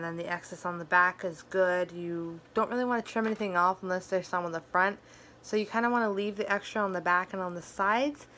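slight lisp from fang caps; talking with fake fangs in. Real, not acted. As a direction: teeth caps on canines. Seen 4: Fang caps plainly on canines while talking, removed around +23 s.

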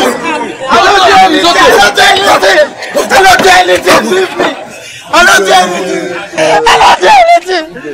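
high, tearful protest of innocence; frantic pleading. Acted. Captive begs the king's men, frantic pleading. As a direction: wail it over and over, begging to be released.

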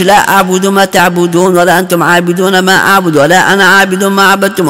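rapid recitation. Retold or imitated. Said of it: "there is too much".

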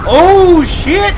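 high, loud startled curse; alarmed shout. Real, not acted. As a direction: yelp the curse as enemies attack. Surprised by enemies in combat.

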